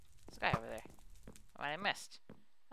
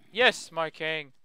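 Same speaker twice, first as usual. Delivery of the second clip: zombie knight; 8 dB louder, slower than usual. Acted. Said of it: "the zombie knight said".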